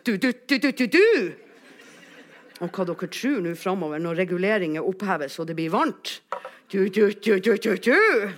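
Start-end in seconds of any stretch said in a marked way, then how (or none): 0.1-1.3 loud, rising toot-toot; imitated steam release. Shown as a demonstration. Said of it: "slippe ut litt sånn kontrollert damp". 6.7-8.3 loud bursts of tooting; imitated steam release. Shown as a demonstration. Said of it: "slippe ut litt sånn kontrollert damp".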